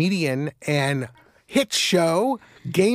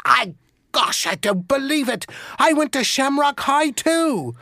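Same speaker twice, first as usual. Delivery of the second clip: much higher, astonished Irish voice; excited Irish accent; 8 semitones higher than usual. Retold or imitated. Second Irishman stunned they went to the same school, high excited brogue. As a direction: burst out in Irish disbelief. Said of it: "identify with the accent".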